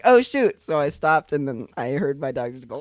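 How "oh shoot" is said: startled. Retold or imitated. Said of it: "and then I was like".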